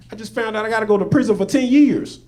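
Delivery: high, half-hearted mock-crying voice; retold tearful complaint. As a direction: imitate an upset young woman, lightly. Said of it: "She over there sniffling".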